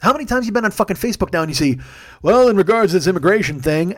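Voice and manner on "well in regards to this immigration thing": louder, put-on voice of a self-important commenter; hammed-up soapbox opinion; mock pundit. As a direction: imitate an online know-it-all opining. Mocking a Facebook pundit's post.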